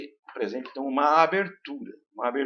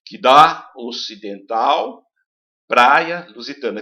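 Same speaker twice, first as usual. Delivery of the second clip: slow and louder, "dá" and "praia" hit hard; open A stressed; 8 dB louder, slower than usual. Shown as a demonstration. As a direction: dwell on every open A in "praia". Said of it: "Veja aqui que o A predomina".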